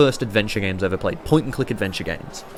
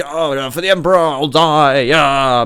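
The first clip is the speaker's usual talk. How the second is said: lightly voiced careless Space Marine, louder; mock Space Marine; 4 semitones higher, 7 dB louder than usual. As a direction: shrug off death like it's nothing. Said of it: "be like".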